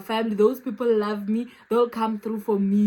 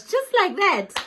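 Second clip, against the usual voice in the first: much higher, amazed; awed quote; 10 semitones higher, 5 dB louder than usual. Retold or imitated. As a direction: gush it in disbelief. Quoting her awe at the lady.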